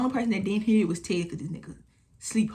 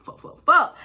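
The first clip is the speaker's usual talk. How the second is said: higher, louder burst of curses; panicked curses; 8 semitones higher, 8 dB louder, slower than usual. Retold or imitated. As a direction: let the panic spill out. Panic builds.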